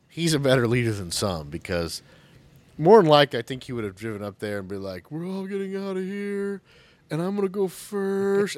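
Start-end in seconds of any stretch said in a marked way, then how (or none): 5.1-8.5 high-pitched mock announcement to the gang; acted leader's promise. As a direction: play the leader rallying everyone, pitched up. Acting out the leader addressing followers.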